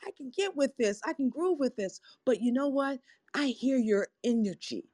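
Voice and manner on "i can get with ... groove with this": mock grooving. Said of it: "I'm like".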